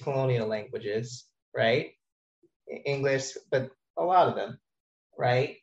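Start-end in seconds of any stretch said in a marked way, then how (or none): none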